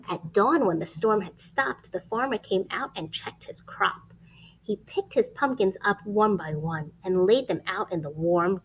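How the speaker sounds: lively rhyming storybook read; reading to children. Acted. Reading a rhyming picture book aloud to children. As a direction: read it like bedtime story time.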